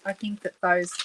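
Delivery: normal talking voice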